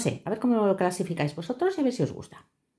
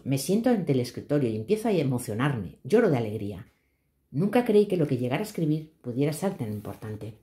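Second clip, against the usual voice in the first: calm storytelling read; story narration; 4 semitones lower than usual. A narrator's reading. Reads her own story aloud.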